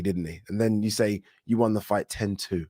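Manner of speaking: ordinary delivery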